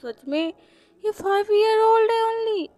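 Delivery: high, rising exclamation of amazement; astonished disbelief. Acted. Ladies marvel at the elegant five-year-old, astonished. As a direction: act out a lady's loud, stunned surprise.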